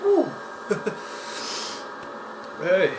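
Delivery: ordinary talk, nothing marked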